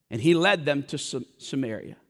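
ordinary talk

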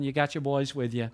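ordinary delivery

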